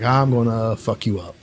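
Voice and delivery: quiet menace of a hero about to fight; menacing threat. Retold or imitated. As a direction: growl it low and slow, dead calm. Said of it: "And he's like".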